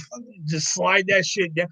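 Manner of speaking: ordinary talk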